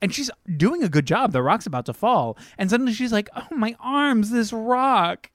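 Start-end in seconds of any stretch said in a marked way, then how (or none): 3.4-5.3 mock whine. Mocking the weak ranger.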